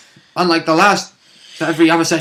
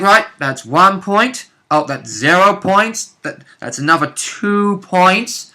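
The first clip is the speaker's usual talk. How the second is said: slow, drawn-out counting of points; unbothered tank tallying damage, slowly; slow mock tally; 6 semitones higher, slower than usual. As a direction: tick off each tiny hit slowly and smugly. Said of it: "I'm like".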